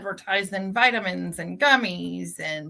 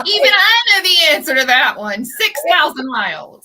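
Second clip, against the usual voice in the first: much louder, fast incredulous jab; 8 semitones higher, 12 dB louder than usual. Real, not acted. Cohost mocking the tire shop's wrong answer, loudly. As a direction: blurt it out in disbelief.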